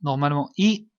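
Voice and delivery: ordinary talk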